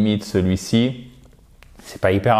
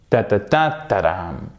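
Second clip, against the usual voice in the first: rhythm in nonsense syllables; 4 semitones lower than usual. Shown as a demonstration. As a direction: beat out word stress as ta-ta-TA-ta-dam. Hummed stress rhythm of the word.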